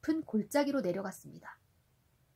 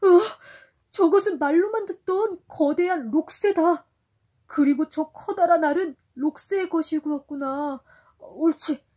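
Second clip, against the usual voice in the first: high, loud amazed exclamation; astonished cry; 5 semitones higher, 10 dB louder than usual. Acted. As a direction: gasp in wonder at the bird. Sindbad sees the giant roc. (놀라서 외치는 소리)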